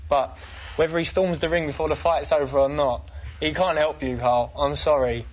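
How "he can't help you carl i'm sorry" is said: usual speaking voice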